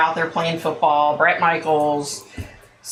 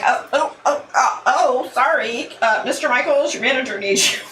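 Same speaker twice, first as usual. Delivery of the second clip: flustered; 6 semitones higher than usual. Retold or imitated. Walking in on naked star.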